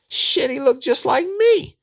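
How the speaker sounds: high, slow put-on voice; excited drawl. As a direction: stretch it out, proud of it. Suspect's eager claim.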